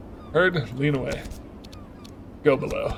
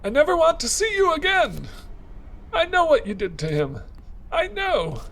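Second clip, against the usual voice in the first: high-pitched tearful woman's voice; a grieving woman lashing out, voice pitched high; sobbing accuser; 11 semitones higher than usual. Acted. Clarissa accusing Veronica through the locked door, high tearful voice.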